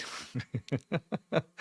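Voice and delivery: soft run of chuckles; real amused chuckling; laughing. Real, not acted. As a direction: laugh quietly at yourself. Laughs at own swearing.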